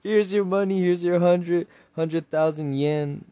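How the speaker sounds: loud, high-pitched insistence; exclaimed payout. Retold or imitated. Loser stopping a slap to pay the winner, loud insistence. Acted loser handing over prize money.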